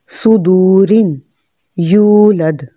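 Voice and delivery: सुदूरिन and यूलद with the long drawn u. Shown as a demonstration. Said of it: "ठीक, लंबा हो गया".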